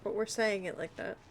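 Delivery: ordinary delivery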